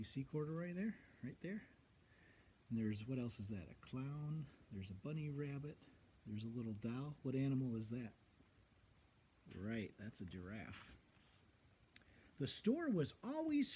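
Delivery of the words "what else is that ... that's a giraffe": ordinary delivery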